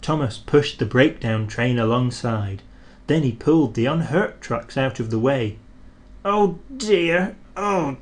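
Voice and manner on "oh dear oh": groaning. A narrator's reading. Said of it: "they groaned".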